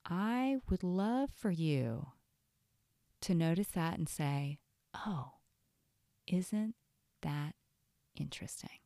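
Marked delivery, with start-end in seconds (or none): 4.9-8.8 detached curiosity. Shown as a demonstration. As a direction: muse word by word, softly trailing away. Models curious noticing of the voice.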